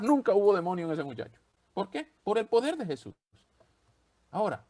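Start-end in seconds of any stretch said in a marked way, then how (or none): none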